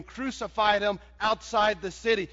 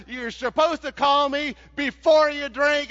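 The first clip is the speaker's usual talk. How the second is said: exasperated Barney; 6 semitones higher, 5 dB louder than usual. Retold or imitated. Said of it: "Barney says".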